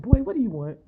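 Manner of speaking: loud, high irritated snap; fed-up mock outburst at the guy; exasperated. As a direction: let him have it, fed up. Annoyed reply in her head.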